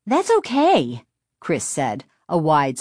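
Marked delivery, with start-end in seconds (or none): 0.1-1.0 put-on high-pitched, happy girl's voice; cheerful girl. A narrator's reading. Chris telling the sorry driver not to worry, cheerful girl. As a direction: reassure him in a high girl's voice.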